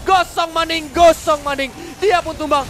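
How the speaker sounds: loud, high hype call; excited caster yell. Real, not acted. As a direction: scream the kill like a caster. Caster yells at a kill. (teriakan komentator yang heboh)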